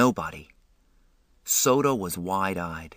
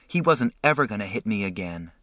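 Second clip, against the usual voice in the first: plain reading voice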